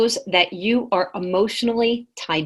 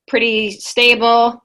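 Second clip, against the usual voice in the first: ordinary delivery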